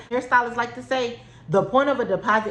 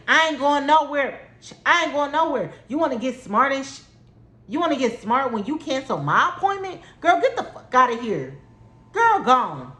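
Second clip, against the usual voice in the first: angry retold yell; 6 semitones higher than usual. Yelling at the stylist who cancelled, angry. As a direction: tell her off, hard.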